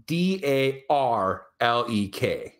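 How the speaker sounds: slow letter-by-letter spelling; measured, deliberate spelling. Shown as a demonstration. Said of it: "I thought it was".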